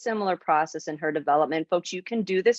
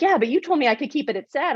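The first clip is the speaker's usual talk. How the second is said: put-on higher, quicker voice for a child objecting; retold child's protest; 7 semitones higher, 3 dB louder, faster than usual. Girl objecting to her coach about staying with sadness, retold as a quick, higher protest. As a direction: lightly mimic a girl pushing back, pitch up and hurried.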